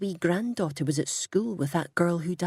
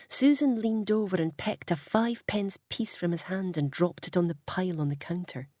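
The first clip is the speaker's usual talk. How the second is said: ordinary delivery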